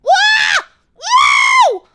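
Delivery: high, loud mock fright yelps; scared shriek. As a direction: shriek as if suddenly startled. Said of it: "If I get scared".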